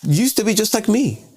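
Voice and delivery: lightly acted neighbor confronting a representative; aggrieved constituent. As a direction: play an aggrieved voter, pitch lifted. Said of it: "I'm yelling at you".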